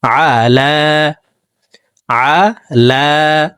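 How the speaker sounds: আলা opened with a throat sound; very slow আলা from mid-throat; guttural ain. Shown as a demonstration. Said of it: "আইন হরফকে হলকের মধ্যখান থেকে উচ্চারণ করব".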